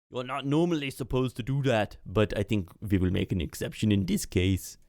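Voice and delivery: put-on accented official granting a favour; Atulf. Atulf makes an exception for the party, accented and obliging. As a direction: bend the rules in a foreign accent.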